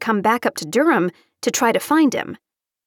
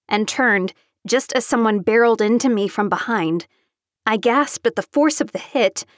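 ordinary delivery, nothing marked

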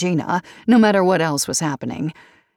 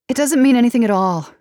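fast, louder, cutting it short; short, curt; 6 semitones higher, 5 dB louder, faster than usual. A narrator's reading. Daughter cutting off her mother's musing, curtly. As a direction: snap it off quickly. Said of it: "Katie said shortly".

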